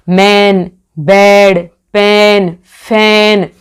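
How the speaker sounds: each word said alone, "मैन", "बेड", "पेन", "फैन", with a held long vowel; stretched long vowel. Shown as a demonstration. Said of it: "ए की मात्रा को खींचा जा रहा है".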